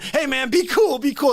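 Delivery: high, mock-scared be cool; hammed-up frightened mugger; nervous pleading.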